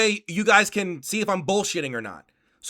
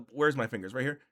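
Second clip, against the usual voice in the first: ordinary talk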